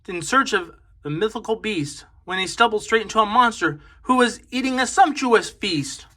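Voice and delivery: ordinary delivery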